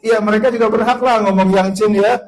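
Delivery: ordinary talk, nothing marked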